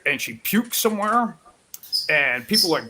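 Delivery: usual speaking voice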